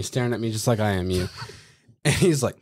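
ordinary delivery

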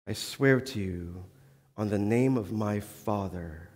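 low, quiet sworn promise, slowing down; solemn oath. Retold or imitated. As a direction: swear it gravely on his father's name. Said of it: "And he says".

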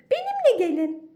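very high, loud chirpy yes; chirping bird. Acted. Bird leads them.

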